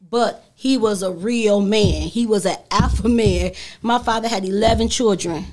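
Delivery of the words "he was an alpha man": normal talking voice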